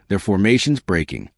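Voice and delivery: ordinary delivery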